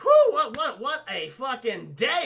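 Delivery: high, loud "whew", then slow; mock exhausted relief. Agents after beating the cockroach, mock relief. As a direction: blow out relief with a laugh.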